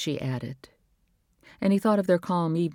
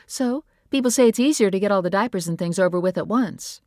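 higher, breezy persuading; lightly voiced wife; 5 semitones higher, 4 dB louder than usual. A narrator's reading. Wife persuading husband about a second baby, breezy. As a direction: coax him lightly. Nora's voice in the story.

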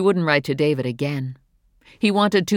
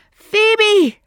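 much louder, much higher shout; 10 dB louder than usual. Acted. Mother shouts for her daughter in the pipe, full shout. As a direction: shout her name with all you have. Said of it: "she shouted".

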